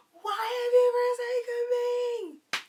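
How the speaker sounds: high, loud, slow anguished cry to heaven; Jesus on the cross. Retold or imitated. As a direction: wail it like a dying martyr. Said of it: "Passion of Christ, Jesus on the cross".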